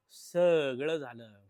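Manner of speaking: very slow, loud and stretched out; drawn-out dramatic. Real, not acted. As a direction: drag each word out for effect. Mock-dramatic summing up, repeated.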